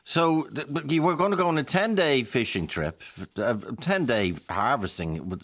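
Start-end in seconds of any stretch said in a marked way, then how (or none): none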